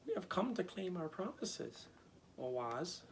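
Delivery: put-on high girlish voice, pleading; Dorothy's voice. A narrator's reading. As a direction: speak up high like a little girl. Dorothy voiced in read story.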